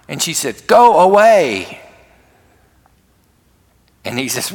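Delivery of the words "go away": high, loud, drawn-out mock yell; retold drawn-out shout; angry rebuff. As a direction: act out an upset girl driving him off, slowly and loudly. Acted upset girl rebuffing boy.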